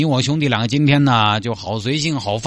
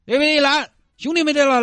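put-on drunk bravado; 9 semitones higher, faster than usual. Drunk man boasting about brotherhood.

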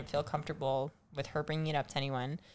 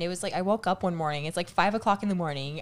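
ordinary delivery

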